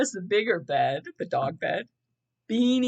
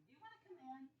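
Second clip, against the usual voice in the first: pet talk; 4 semitones higher, 32 dB quieter, much faster than usual. Real, not acted. Talking softly to her dog.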